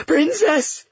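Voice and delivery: very high, strained call; desperate cry. A narrator's reading. Trapped people call up to the princess, desperate. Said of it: "the mournful sound of cries".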